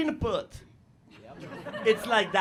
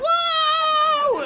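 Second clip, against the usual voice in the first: high, loud excited whoop; hammed-up crowd shout; 7 dB louder than usual. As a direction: yell it out in the cinema.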